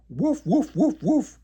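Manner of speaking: high, loud happy barks; joyful yapping. A narrator's reading. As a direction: bark for joy. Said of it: "Le Père Noël jappe joyeusement".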